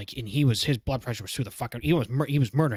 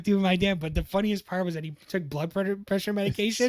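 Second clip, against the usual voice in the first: normal talking voice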